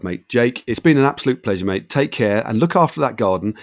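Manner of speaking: ordinary talk, nothing marked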